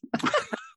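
real amused laugh; quick bright laugh. Real, not acted. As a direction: laugh at his cheeky line. Wife laughs at husband's quip.

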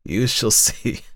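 high, teasing hag voice; sly old woman taunting the lawyer; mocking old crone. A narrator's reading. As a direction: leer at him with a wheeze. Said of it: "laughed again, the same curious, toneless chuckle".

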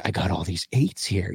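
low, hushed shady dealer voice; secretive raspy sales murmur. Retold or imitated. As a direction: mutter it like a shady seller. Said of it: "They're like".

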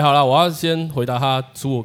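ordinary delivery

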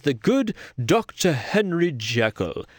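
put-on posh English accent, drawn out; classy English accent. Introducing Jekyll to viewers, mock classy English accent. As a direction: do a refined English doctor. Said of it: "classy English accent".

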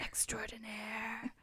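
breathy mock-dramatic whisper. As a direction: whisper it like a secret.

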